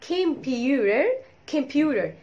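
"كمبيوتر" in American accent, T as a tapped r, "kem-PYU-rer"; American accent computer. Shown as a demonstration. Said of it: "ده بالامريكان اكسنت".

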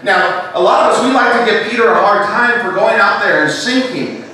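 high, loud pulpit delivery; full-force Sunday sermon; fervent preaching. Acted. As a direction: drive it home to the congregation.

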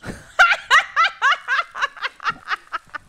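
loud shrieking laughter; high cackling laugh. Real, not acted. GM bursts out laughing.

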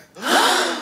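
high, loud mock gasp; startled gasp. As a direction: gasp sharply as if caught off guard. Acts out surprise at seeing him.

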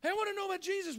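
high-pitched mock call. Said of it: "is calling out".

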